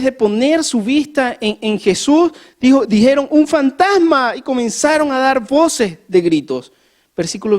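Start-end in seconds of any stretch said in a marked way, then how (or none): 3.3-4.3 mock terrified cry, higher and louder, slow; grito de miedo. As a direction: cry out like a frightened disciple. Grito de los discípulos otra vez. (grito asustado, más agudo y fuerte, lento)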